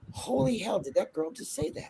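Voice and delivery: hushed, scandalised aside; soft mock shock; retold shocked onlookers. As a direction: imitate a bystander murmuring in disbelief.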